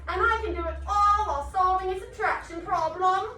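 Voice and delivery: high, loud put-on British chant; hammed-up British accent. Shown as a demonstration. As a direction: lead a chant in playful British. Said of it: "in the best British accent".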